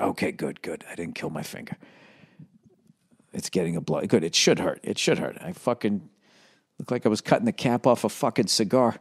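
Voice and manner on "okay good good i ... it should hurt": relieved murmur. Retold or imitated. Relieved self-talk at dawn.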